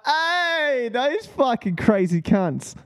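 high, drawn-out "hey" easing down; imitated delighted onlookers. Texans greeting crazy Australians, imitated with high-pitched delight.

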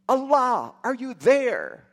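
high desperate cry out. Retold or imitated. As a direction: call out to God in despair. Desperate man crying to God.

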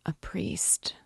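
barely a breath of voice; whispered again. A narrator's reading. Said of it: "the dying man said again".

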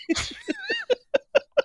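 a sudden high squeal of a laugh; high burst of laughter. Real, not acted. Recalling mom's question, a high laughing squeal.

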